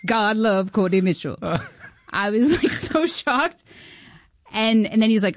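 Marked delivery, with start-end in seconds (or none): none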